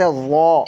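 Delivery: ضا said heavy and full, thick in the mouth; a weighty ضا; heavy full zwad. Shown as a demonstration. Tutor demonstrating heavy ضا to a student. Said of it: "heavy sound میں".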